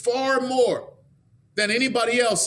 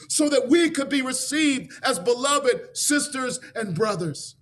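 rising sermon; 4 semitones higher than usual. Acted. Preacher telling brothers and sisters they are freed, building to a shout. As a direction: lift the congregation toward praise. Preacher applies story to congregation.

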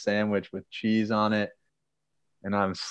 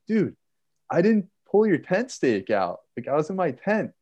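high-pitched mock exasperation; retold protest; 9 semitones higher than usual. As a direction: re-enact pleading innocence to a friend. Acted quarrel, protesting his innocence.

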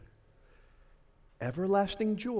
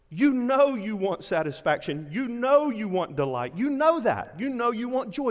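louder and higher, pressing each you know; rising insistence; 5 semitones higher, 5 dB louder than usual. Acted. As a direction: build it like a crescendo at the pulpit. Preaching climax, building insistence.

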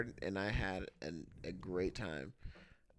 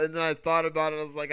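ordinary delivery

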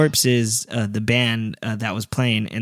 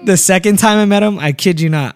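ordinary talk

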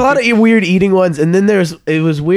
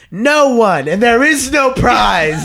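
much higher, slow announcer-style reveal; dramatic reveal; 8 semitones higher, slower than usual. Retold or imitated. Camp leader tells the teams there is no prize, slow hammed-up reveal. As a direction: draw it out like a big letdown.

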